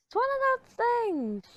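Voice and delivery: sing-song high. Shown as a demonstration. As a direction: toss it off in a high playful tone. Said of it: "repeats the phrase".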